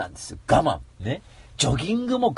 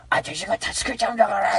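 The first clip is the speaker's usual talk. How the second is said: a higher, cutesy cat sidekick voice; cat partner promising to save him, in a cutesy voice; 5 semitones higher than usual. Pretend. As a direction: play the plucky cat companion. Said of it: "うーちゃんが".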